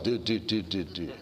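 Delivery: low droning "do do do" like a dial tone; imitated phone signal sound; mock phone tone. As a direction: hum the phone beeps, dropping away.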